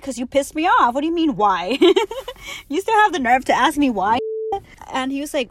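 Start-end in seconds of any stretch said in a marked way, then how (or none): 0.0-1.8 louder, higher indignant snap; a louder mock outraged retort. Acted quarrel with ex on phone. 1.8-2.6 sudden high burst of laughter; short high laugh. Real, not acted. Laugh inside quarrel retelling. 2.7-4.2 louder, higher indignant snap; a louder mock outraged retort. Acted quarrel with ex on phone.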